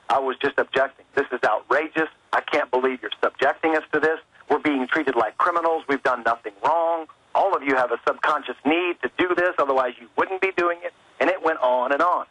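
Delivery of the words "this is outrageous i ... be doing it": ordinary talk, nothing marked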